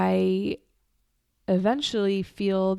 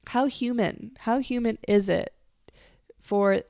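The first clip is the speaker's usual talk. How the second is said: ordinary delivery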